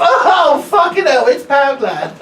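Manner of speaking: put-on loud, high drunken shriek; drunk woman. Stand-up comic playing a drunk woman at the door, loud and high. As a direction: shout it like a plastered shopper.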